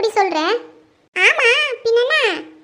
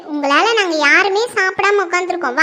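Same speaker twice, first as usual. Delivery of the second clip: usual speaking voice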